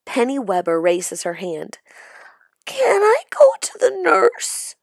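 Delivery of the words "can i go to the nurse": put-on high, whimpering whine of a sick girl; whining. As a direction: whine it like a child who wants out. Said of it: "she whines".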